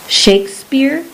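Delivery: wife echoing the stranger's words in mild surprise; surprised echo. A narrator's reading. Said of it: "she echoed".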